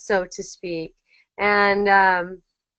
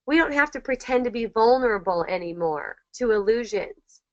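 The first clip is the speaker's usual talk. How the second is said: ordinary delivery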